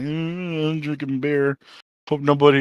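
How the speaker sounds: drawn-out, half-hearted mock moan; imitating someone moping tearfully; mock crying. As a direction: mumble like a weepy, self-pitying drinker. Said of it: "like, fucking crying and".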